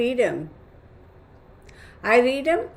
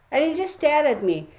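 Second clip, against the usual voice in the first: usual speaking voice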